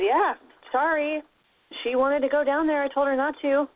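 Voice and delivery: a god casually refusing to help; offhand shrug. Retold or imitated. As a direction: toss it off like it's not your problem.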